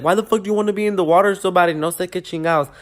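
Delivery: angry trainer yelling. Retold or imitated. Instructor yelling at a student who jumped early, angry. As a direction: rattle it off like an exasperated instructor.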